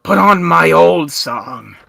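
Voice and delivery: slow, loud, drawn-out demand; bossy complaint. Acted. Zogmar ordering the illithid, loud and slow. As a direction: insist on the old song, leaning on it. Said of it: "you hear Zogmar go".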